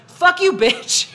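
drawn-out retold abuse; imitated angry driver. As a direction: hurl it like the rude driver. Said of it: "and he went".

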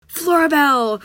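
loud call of her name across the yard; shouted. Acted. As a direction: call out to Flora from afar. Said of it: "shouted William Spiver".

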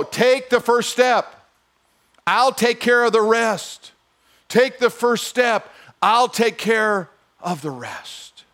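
slow, high, loud and deliberate; slow solemn. Acted. God promising the hesitant believer, slow and solemn. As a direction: lay it down like a promise. God's words repeated slowly.

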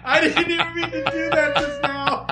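high, laughing through words. Real, not acted. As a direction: giggle through it. Laughing at own reflex.

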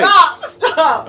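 real laughter, high and loud; helpless, high-pitched laughing plea; laughing protest. Real, not acted. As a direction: cry out for them to stop while cracking up. Laughing protest at co-host's joke.

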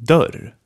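dörr, short open ö, for repeating. Shown as a demonstration. Teacher asking students to repeat dörr, short vowel. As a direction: say dörr crisply for learners. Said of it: "Say after me".

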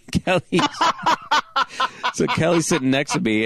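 laughing. Real, not acted.

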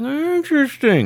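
high, slow drawn-out interested; mock intrigued; wry interest. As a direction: stretch it out with a knowing smirk.

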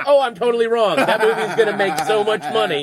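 high, loud comic about-face; hammed-up excited admission of being wrong; retold sudden realization. Film snob reacting to the teenager, loud excited realization. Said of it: "and I was going".

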